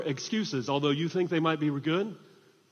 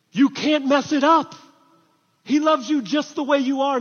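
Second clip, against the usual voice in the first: raised, high-pitched proclamation; emphatic preaching; 8 semitones higher, 7 dB louder than usual. Real, not acted. Preacher assures congregation of God's love, emphatic and loud. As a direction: call it out to the whole room.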